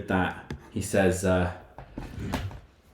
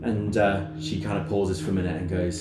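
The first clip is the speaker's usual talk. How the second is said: ordinary talk, nothing marked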